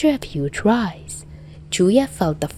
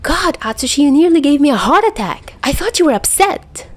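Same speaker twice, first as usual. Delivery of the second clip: high, loud relieved outburst; relieved complaint; 9 semitones higher, 4 dB louder than usual. A narrator's reading. Dazai complaining to Atsushi after a scare, loud and high. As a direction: gasp out the relief, scolding. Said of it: "Dazai complained".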